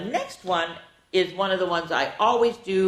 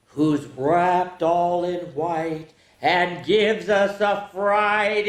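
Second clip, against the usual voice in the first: slow spooky reading; 4 semitones higher, 3 dB louder, slower than usual. Acted. Reading riddles to kids.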